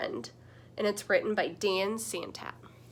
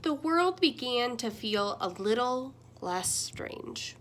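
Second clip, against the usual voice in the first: storybook reading. A narrator's reading. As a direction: read it like bedtime story time with little ones. Reads picture book aloud.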